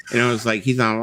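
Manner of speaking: ordinary delivery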